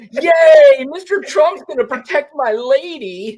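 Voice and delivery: very high squealing cheer, laughing; cheering dumb fan. Retold or imitated. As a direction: shriek it like a gullible fan. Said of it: "the guy cheering".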